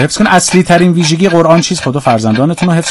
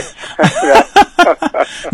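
laugh; 13 semitones higher than usual. Real, not acted.

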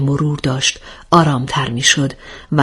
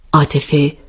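usual speaking voice

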